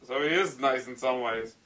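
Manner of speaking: ordinary talk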